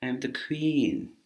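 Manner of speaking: high, very posh regal voice; hammed-up posh English monarch; the Queen. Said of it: "very posh, like the Queen".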